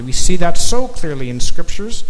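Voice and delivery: ordinary delivery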